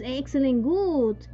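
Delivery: ordinary talk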